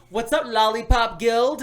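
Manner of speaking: high, slow put-on friend voice; imitating Zach. Mimicking Zach calling in a good mood, high and slow. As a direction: drag out the greeting in a goofy buddy voice. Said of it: "he goes".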